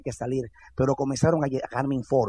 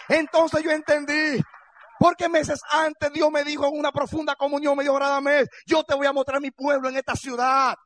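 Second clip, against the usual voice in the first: higher, impassioned sermon delivery; fervent preaching; 9 semitones higher than usual. Acted. Pastor telling congregation his dream, impassioned preaching. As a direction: preach the vision with rising fire.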